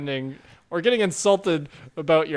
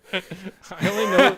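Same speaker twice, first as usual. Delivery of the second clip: laughing; 4 semitones higher, 3 dB louder, slower than usual. Real, not acted. Laughing mid-remark.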